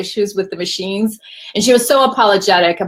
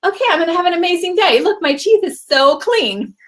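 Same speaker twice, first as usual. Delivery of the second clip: higher, quick then slowing cheerful voice; upbeat self; 9 semitones higher than usual. Retold or imitated. Retelling her changed mood, a higher upbeat chirp. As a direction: chirp it like the day just turned great. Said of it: "now I was like".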